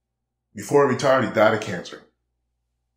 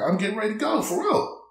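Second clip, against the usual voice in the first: insisting; 10 semitones higher than usual. Retold or imitated. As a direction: pitch climbs much higher to insist it's true. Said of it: "I was like".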